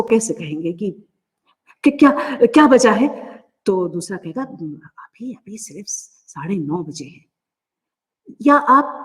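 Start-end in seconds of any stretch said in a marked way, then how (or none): 1.8-3.1 anxious, hurried question, higher pitched; scared, late student. Shown as a demonstration. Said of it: "वो बहुत डर गया है". 4.4-7.2 very soft, unhurried calming voice; reassuring. Shown as a demonstration. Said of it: "घबराओ मत".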